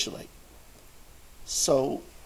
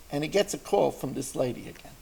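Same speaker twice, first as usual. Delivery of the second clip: ordinary talk, nothing marked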